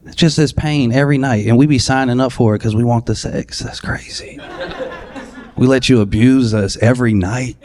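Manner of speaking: normal talking voice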